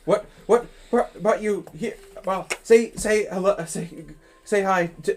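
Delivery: high, loud, tumbling eager questions; excited questions. Acted. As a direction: bubble over with delight. Tiefling excitedly presses questions.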